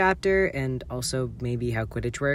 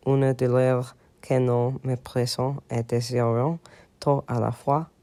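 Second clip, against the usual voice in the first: French. Shown as a demonstration. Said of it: "I don't remember how I said this".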